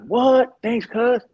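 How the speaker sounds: loud, high-pitched acted delight; mock excitement. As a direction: jump at the offer, playing it up. Retold eager reply to paid offer.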